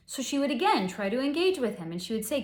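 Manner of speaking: normal talking voice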